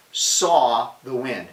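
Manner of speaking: "saw" drawn out very slowly, then a pause; stress on saw. Shown as a demonstration. Preacher breaking the verse down, "saw" stretched out with heavy stress. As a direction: dwell on "saw" as the puzzling word.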